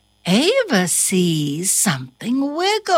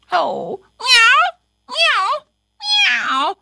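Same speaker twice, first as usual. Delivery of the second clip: much higher, louder meows; cat meows; 14 semitones higher, 4 dB louder than usual. Acted. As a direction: call out like a lost kitten. Cat searching, meowing.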